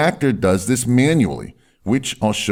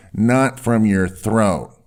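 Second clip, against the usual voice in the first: slow, from the diaphragm; much slower than usual. Shown as a demonstration. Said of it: "speak more from your diaphragm".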